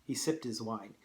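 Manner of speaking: ordinary talk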